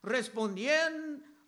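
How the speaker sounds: usual speaking voice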